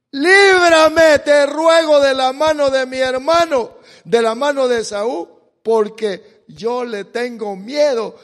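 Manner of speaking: very loud, high, desperate begging; fearful plea. Acted. Jacob begging God to save him from Esau, loud desperate plea. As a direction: beg to be spared from the brother you fear.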